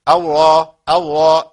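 الراء with exaggerated thick emphasis and rounded lips pushed forward; overdone heavy ra. Shown as a demonstration. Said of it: "ما ينفعش أبدًا إن أنا الراء أبالغ في تفخيمها". (راء مفخمة بمبالغة والشفتان ممدودتان للأمام)

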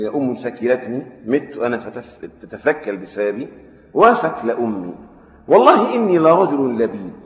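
slow formal classical Arabic reading; hadith recitation. A narrator's reading. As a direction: read the text slowly and solemnly. (قراءة الحديث ببطء ووقار)

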